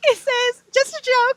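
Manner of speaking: high and loud through laughter; giggling at the punchline; laughing. Real, not acted. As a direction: squeal it out mid-laugh. Laughing while retelling.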